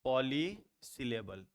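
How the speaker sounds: पॉलीसिलेबल slowly, with a gap after poly; slowly divided term. Shown as a demonstration. Teacher naming the term for learners, पॉलीसिलेबल slowly in two parts. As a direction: draw out poly, pause, then syllable. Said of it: "इसलिए इसको हम लोग कहते हैं पॉलीसिलेबल".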